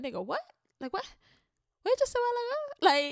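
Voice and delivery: rising, disbelieving what? what?; baffled. Retold or imitated. To a Jamaican giving a backhanded compliment, baffled disbelief. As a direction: pitch jumps way up on the last question in mock confusion.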